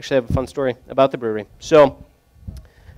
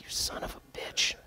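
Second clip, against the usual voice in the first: laughing whisper; 18 dB quieter than usual. Retold or imitated. Retorting to friend's teasing.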